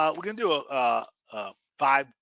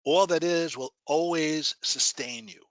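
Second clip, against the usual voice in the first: guided meditation. Acted. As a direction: guide them gently into relaxation.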